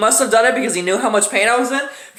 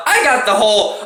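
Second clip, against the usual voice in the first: usual speaking voice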